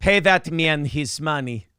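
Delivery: put-on slow, deliberate Russian accent. Quoting the Rounders villain, in a Russian accent. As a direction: draw it out like a Russian card player. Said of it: "She doesn't like his Russian accent".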